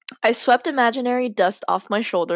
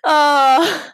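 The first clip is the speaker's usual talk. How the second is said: loud, higher-pitched drawn-out groan; exasperated groan; 7 semitones higher, 6 dB louder than usual. Real, not acted. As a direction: groan at how awful that was. Reacts to finishing terrible story.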